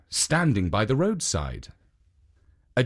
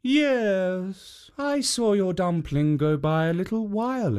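a hint of a higher kindly statue; lightly raised guardian voice; lightly voiced statue; 3 semitones higher than usual. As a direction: warn her gently as a guardian. Second Jitso warns her.

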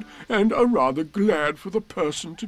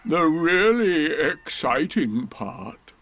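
slow, higher-pitched doleful donkey; most melancholy voice; 5 semitones higher, 3 dB louder, much slower than usual. Acted. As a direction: drag it out with heavy self-pity. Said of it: "said Eeyore in his most melancholy voice".

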